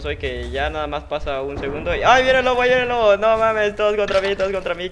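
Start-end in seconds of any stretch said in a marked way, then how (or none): 2.0-4.9 much higher and louder, frantic; panicked shout. Real, not acted. Gamer chased by the wolf, panicked shout. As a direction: yell in a panic.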